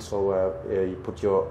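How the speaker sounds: ordinary talk, nothing marked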